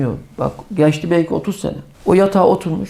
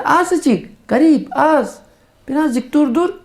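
high, begging voice of a dying man pleading for a little more time; 8 semitones higher, much slower than usual. Acted. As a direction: beg like a man at death's door. Dying man begs God for time. (ölüm döşeğinde yalvaran yüksek ses)

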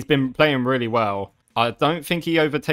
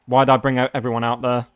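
normal talking voice

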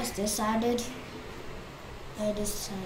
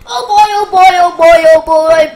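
excited; 3 semitones higher, 18 dB louder than usual. Real, not acted. Excited cheer at new world.

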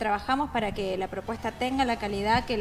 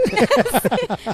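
high, louder laugh; 8 semitones higher, 8 dB louder than usual. Real, not acted. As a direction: laugh at the host's joke. Host teases the band.

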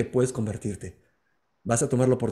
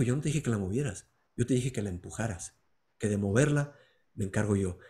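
softer, lower and calm; Jesus gently correcting his son; gentle Jesus; 3 semitones lower, 7 dB quieter than usual. Acted. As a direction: answer him kindly, like a patient father. Jesus answers gently again.

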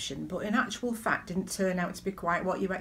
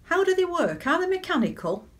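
high, puzzled questioning; quizzical online commenter; 10 semitones higher than usual. Retold or imitated. Preacher voicing a curious commenter, high and puzzled. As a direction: ask it like a baffled shopper. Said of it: "somebody had said".

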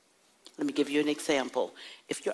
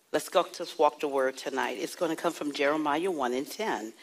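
ordinary talk, nothing marked

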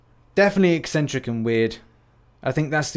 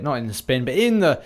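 usual speaking voice

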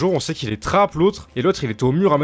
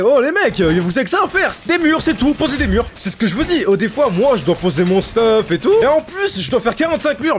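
higher, worked-up complaint; exasperated scolding; 8 semitones higher, 4 dB louder than usual. Real, not acted. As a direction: nag the team, rising in pitch to an indignant peak. Scolds teammates about building walls. (râle exaspéré)

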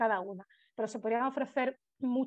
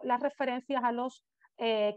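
ordinary talk

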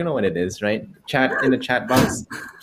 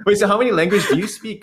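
excited question; 8 semitones higher, 5 dB louder, faster than usual. Real, not acted.

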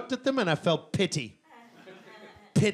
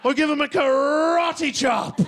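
mock karate yell; 8 semitones higher, 5 dB louder, slower than usual. Stand-up rant about toddlers.

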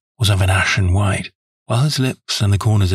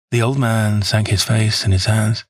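ordinary delivery

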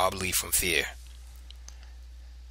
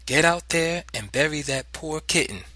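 mother's raised-pitch command; stern order; 6 semitones higher than usual. A narrator's reading. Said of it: "she ordered".

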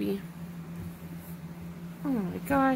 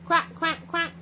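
higher, short repeated quacks; duck quack; 4 semitones higher than usual. Pretend. Parent demonstrating for a toddler, playful duck quack. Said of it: "quack like a duck".

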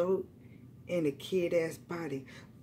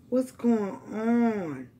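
usual speaking voice

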